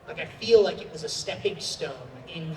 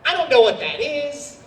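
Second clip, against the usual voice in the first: surprised; 8 semitones higher, 9 dB louder than usual. Retold or imitated. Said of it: "And I'm like".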